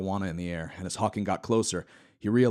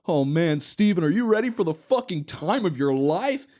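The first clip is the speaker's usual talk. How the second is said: higher, louder hyped shout; excited party host; 10 semitones higher, 7 dB louder than usual. Acted. Epstein hyping Hawking, higher and louder. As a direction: pump him up wildly. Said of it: "He jogged excitedly over to the men".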